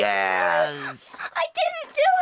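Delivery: plead, drawn out; falsely accused child protesting; desperate protest. Acted. Pupil protests innocence.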